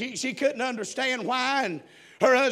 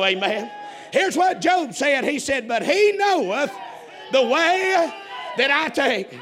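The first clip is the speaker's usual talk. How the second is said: full-force preaching; 3 semitones higher than usual. Real, not acted. Preacher testifies to congregation what he knows, full-force preaching. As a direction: proclaim it to the congregation with rising fervour.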